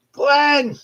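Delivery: high, loud call across a room; imitated shout. Woman calling her colleague across the warehouse, shouting. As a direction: holler the name to get his attention. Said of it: "she shouts down".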